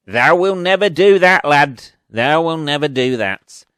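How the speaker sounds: hammed-up Northern accent. Film parent telling the lad he'll never do it, hammed-up Northern accent. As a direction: bellow it as a disapproving Northern parent. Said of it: "Northern accent you've, uh, ever heard".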